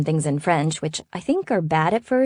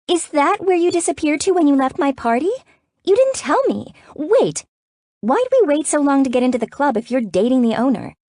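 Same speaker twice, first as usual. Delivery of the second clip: high-pitched, worked-up rapid questioning; shocked interrogation; 10 semitones higher, 4 dB louder than usual. A narrator's reading. Sister finding out about the club owner, worked up. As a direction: fire indignant questions in a high voice.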